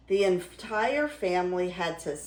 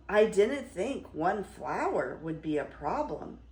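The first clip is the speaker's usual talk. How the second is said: usual speaking voice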